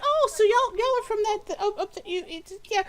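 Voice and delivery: very high, excited, tripping over words; eager hostess calling her husband over; jolly halfling woman. Acted. Jolly halfling wife calling her husband over, high and excited. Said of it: "loud and boisterous and jolly".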